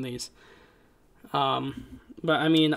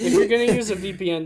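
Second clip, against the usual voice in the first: laughing; 4 semitones higher, 6 dB louder, faster than usual. Real, not acted. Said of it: "laughing while talking".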